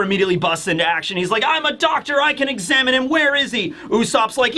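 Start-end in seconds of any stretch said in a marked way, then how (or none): 1.5-3.7 high, eager acted urgency; high-pitched keen little doctor; urgent doctor. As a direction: burst in ready to help. Chopper bursts into action.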